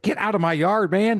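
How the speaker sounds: high, shooing the deer off; hammed-up get-lost call at a deer; mock yard yell. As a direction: homeowner yelling at a deer in the yard. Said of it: "I was like".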